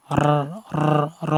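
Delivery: "র" rolled on the tongue tip with a heavy rounded vowel; trilled R. Shown as a demonstration. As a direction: tap out a rolled r, then a full "র". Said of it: "তাকরার করতে হবে". ("র" জিহ্বা কাঁপিয়ে মোটা করে)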